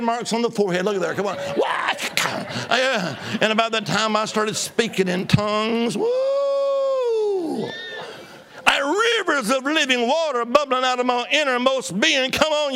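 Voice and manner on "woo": joyful whoop. Real, not acted. Testimony, bursting into tongues.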